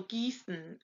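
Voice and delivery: Gießen with a long "ee" and hissed ss; soft, slow model of Gießen, IE as "ee"; German IE sound. Shown as a demonstration. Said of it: "they say E".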